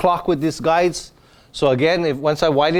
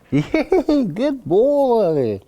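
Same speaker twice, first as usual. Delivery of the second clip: excited praise; 7 semitones higher, much slower than usual. Real, not acted. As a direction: praise the dog like you're thrilled. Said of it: "I'm gonna praise him a lot".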